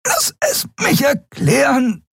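high, slow strangled plea; gasping. A narrator's reading. Choking woman pleads to explain, gasping. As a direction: pant the plea through a squeezed throat. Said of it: "keuchte Endriel".

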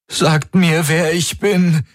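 high, desperate demand; panicked. A narrator's reading. Man begging strangers to tell him who he is, panicked. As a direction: beg for an answer in rising panic. Amnesiac panics, begs for identity.

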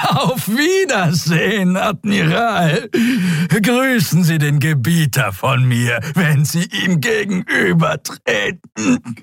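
high, slow, cackling creature voice; gleeful dying Draxyl. A narrator's reading. Dying reptile bidding the admiral goodbye, high mocking cackle. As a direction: crow a mocking farewell, drawn out and high. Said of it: "begann zu lachen".

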